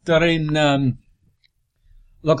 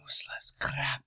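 whispered; 3 semitones lower, 14 dB quieter than usual. Acted. Muttered aside.

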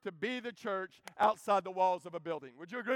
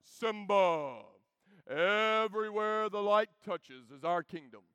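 voice of God; slower than usual. Retold or imitated. Mufasa to his son in a slow voice of God. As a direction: proclaim it slowly like God himself. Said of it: "like, voice of God".

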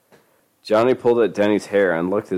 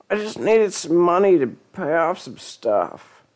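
high lying voice; 10 semitones higher than usual. Acted. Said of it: "he lied quickly".

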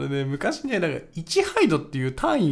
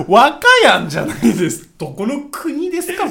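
mock indignant correction; 9 semitones higher, 12 dB louder than usual.

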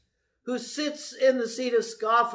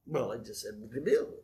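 low, softer imitation of a clueless reply; sheepish mumbled reply; 11 semitones lower, 8 dB quieter than usual. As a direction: act caught out, voice dropped low. Caught out repeating a phrase.

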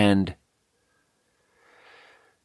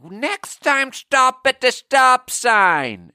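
hammed-up high screech, slow and drawn out; aggro old man; much slower than usual. Mad old man repeats his order to driver, aggro high screech. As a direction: stretch each word in a strained geezer pitch. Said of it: "He's aggro".

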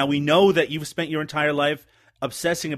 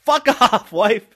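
higher, louder, laughing retort; laughing mock yell; 7 semitones higher, 7 dB louder, slower than usual. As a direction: bark it out through a laugh. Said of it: "And I said".

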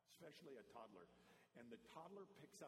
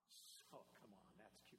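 usual speaking voice

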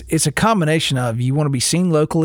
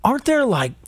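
rising, eager interruption; excited; 7 semitones higher, slower than usual. Real, not acted. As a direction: jump in, suddenly struck by an idea. Interrupts with sudden realization.

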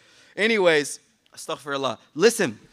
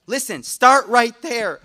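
emphatic; 8 dB louder, slower than usual. Real, not acted. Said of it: "emphatic call".

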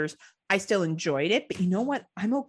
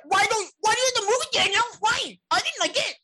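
high, mock-outraged whine, much higher; imitating angry Tim; 4 dB louder than usual. Host mimics Tim bullying Daniel over the movie, high mock outrage. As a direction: play up Tim's indignant rant. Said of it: "He was really upset".

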